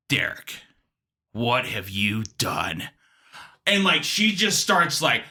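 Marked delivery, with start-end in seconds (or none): 0.1-2.9 low, slow mock reproach; ominous accusation. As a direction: accuse him slowly, in a low voice. Caller's accusation, acted.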